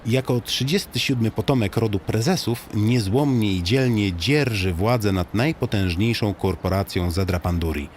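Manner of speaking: ordinary delivery, nothing marked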